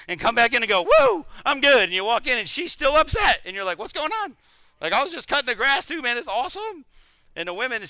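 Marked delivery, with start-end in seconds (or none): 0.8-1.8 mock relief. Husband relieved after going outside. 3.8-6.8 clueless husband. Retold or imitated.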